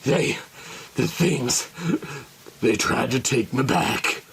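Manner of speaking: low, halting, shaken. Acted. Goldie to his companions, shaken and halting. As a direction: barely get the words out.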